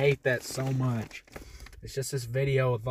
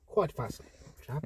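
mock British accent; 6 dB quieter, much faster than usual. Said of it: "a bit of a problem with my accent".

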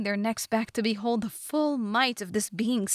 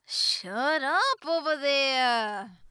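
higher, long drawn-out holler; Zubora; 5 semitones higher, much slower than usual. A narrator's reading. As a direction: call slowly across the room to silence someone. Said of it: "Zubora said to the giant again".

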